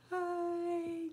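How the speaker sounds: high little hi; squeaky hi. Real, not acted. Streamer greeting a new viewer with a high squeaky hi. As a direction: pop a tiny high hi.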